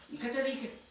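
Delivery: high, exasperated mock scold. Parent scolding the kid for disobeying, high mock scold. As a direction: play the fed-up parent scolding.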